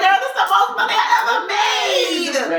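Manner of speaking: high, loud, laughing gush over the money; thrilled laughing squeal; excited. Retold or imitated. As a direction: squeal with delight at the big offer. Excited over big job offer.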